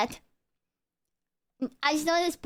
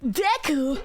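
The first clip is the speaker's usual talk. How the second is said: strained cartoon-boy impression, leaping up high; Deku; 3 semitones higher than usual. Shown as a demonstration. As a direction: put on an anime character voice. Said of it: "It's me, Deku".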